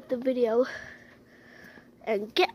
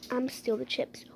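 much softer, under the breath; muttered plan; 4 semitones lower, 12 dB quieter than usual. Pretend. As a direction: plot it quietly so no one hears. The dog plots under its breath.

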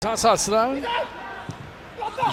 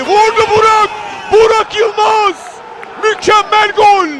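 loud excited goal call; screaming football commentator; 3 semitones higher, 6 dB louder than usual. Real, not acted. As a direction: yell the scorer's name.

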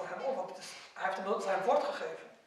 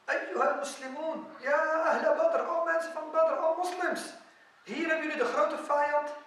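high rallying cry to a crowd; retold battlefield call, pitched high; 6 semitones higher, 4 dB louder than usual. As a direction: summon everyone with a raised, ringing call. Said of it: "riep hij in de moslims".

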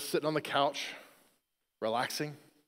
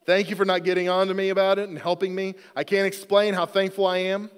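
higher, louder, emphatic read-out; reading child's card aloud; 4 semitones higher, 6 dB louder than usual. Retold or imitated. Dad reading daughter's thank-you card to the congregation, raised emphatic voice. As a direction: proclaim the card with earnest, punchy delivery.

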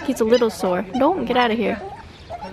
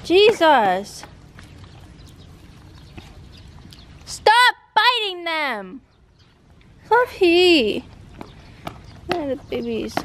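yelling at the dog; 5 semitones higher, slower than usual. Real, not acted. Owner yelling at the dog to stop biting. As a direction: shout the dog off the puppies.

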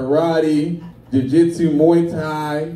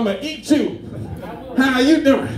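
high-pitched movie character imitation; Rasputia; 8 semitones higher than usual. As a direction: mimic an overbearing comic film woman, pitched up. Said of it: "You remind me of that Rasputia".

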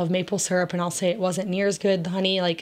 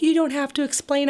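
imitating sweet commenters; 6 semitones higher than usual. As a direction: mimic kind viewers, pitched up and eager. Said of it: "I'm gonna get all these nice people like".

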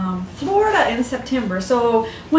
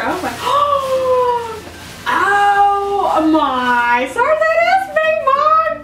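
excited; 11 semitones higher, slower than usual. Real, not acted. Unboxing a gift from a fan, high delighted squeal. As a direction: gasp and squeal over the toy.

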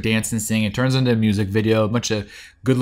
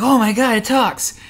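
much higher, amazed squeal; mock amazement; 11 semitones higher than usual. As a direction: play the kids gasping at a talking room. Kids amazed by talking library.

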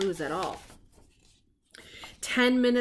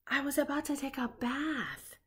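lightly voiced puppet, higher and softer; a hint of Ernie correcting him; 5 semitones higher, 6 dB quieter than usual. A narrator's reading. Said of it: "said Ernie".

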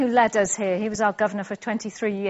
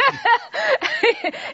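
laughing. Real, not acted. Host laughs loudly.

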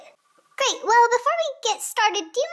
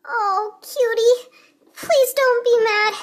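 slow, crying apology; tearful plea; 2 semitones higher, slower than usual. Acted. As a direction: beg forgiveness through tears. Confessing to friend, upset.

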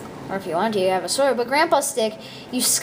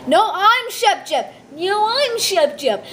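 silly high voice; 7 semitones higher, slower than usual. Pretend. Kid repeating a silly name, building.

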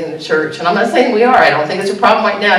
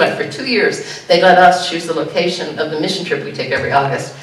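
ordinary talk, nothing marked

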